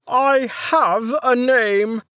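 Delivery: slow, weary sigh of a line; long-suffering and worn out; tired. A narrator's reading. Minder answering the nickname, slow and tired. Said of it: "Beetle Butt said tiredly".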